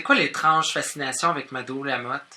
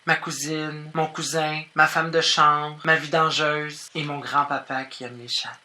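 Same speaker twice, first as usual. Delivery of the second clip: normal talking voice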